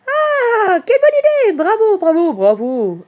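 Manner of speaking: very high, loud cheer, coming down at the end; cheering approval. A narrator's reading. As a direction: cheer the idea like a group of friends. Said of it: "approuvent les amis". (acclamation aiguë et enthousiaste)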